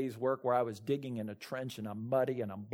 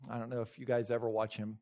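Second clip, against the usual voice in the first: ordinary talk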